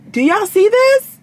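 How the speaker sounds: showing the viewers, pitch jumping up; excited. Real, not acted. Vlogger showing viewers her inbox, excited and high.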